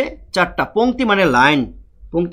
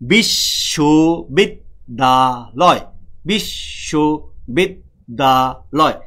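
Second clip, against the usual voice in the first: very slow, syllable by syllable; teacher spelling out a word slowly by its sounds; slow syllable demo; 3 semitones lower, much slower than usual. Shown as a demonstration. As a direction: stretch each syllable out for the class. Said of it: "তাহলে বিশ".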